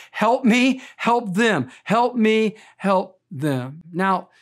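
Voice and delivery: ordinary talk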